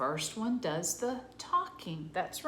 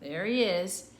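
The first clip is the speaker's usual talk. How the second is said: ordinary delivery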